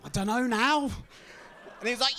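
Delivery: ordinary delivery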